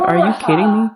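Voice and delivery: mad. Acted. Sister scolding the podcast spender, acted mad. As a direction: throw it out in indignant disbelief. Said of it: "get mad and say".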